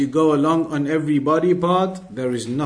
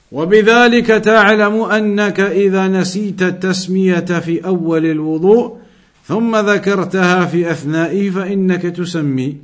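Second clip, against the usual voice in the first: brisk, louder Arabic text reading; reading the book aloud; 4 semitones higher, 6 dB louder, much faster than usual. Acted. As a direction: rattle off the Arabic passage from the book.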